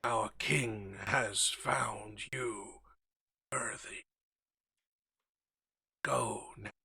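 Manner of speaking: slow eerie whispered guard voice; solemn undead whisper, drawn out; whispering skeleton. Acted.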